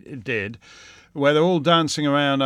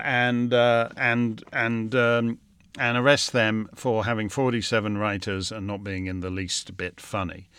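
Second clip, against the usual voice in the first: ordinary delivery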